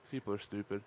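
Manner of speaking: ordinary delivery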